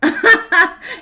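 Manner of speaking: high, loud cackling burst; real loud laughter; amused laugh. Real, not acted. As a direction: laugh hard at the overreaction. Laughs at the overreaction.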